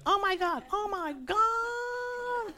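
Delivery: very high drawn-out squeal; squealing excitement. Retold or imitated. Imitating a believer thrilled by God's promise, squealing high. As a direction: squeal like a giddy fan.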